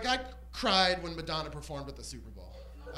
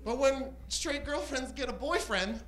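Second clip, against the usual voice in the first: normal talking voice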